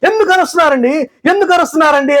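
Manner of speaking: wife nagging the pastor about his shouting, high; scolding pastor's wife. Retold or imitated. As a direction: play the fussing wife scolding her husband. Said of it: "అని చెప్పి అడిగింది".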